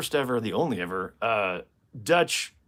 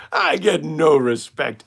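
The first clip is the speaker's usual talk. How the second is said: loud, slow complaining comedian; put-upon stand-up catchphrase, drawn out; Rodney Dangerfield; 3 semitones higher, 8 dB louder, slower than usual. Acted. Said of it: "What about Rodney Dangerfield?".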